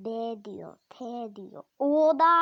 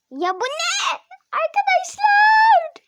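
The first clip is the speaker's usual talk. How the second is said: much higher, loud shriek of disbelief; shocked shout; 6 dB louder than usual. Real, not acted. Gamer shrieking to viewers at a game surprise. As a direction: yelp out as if something awful happened.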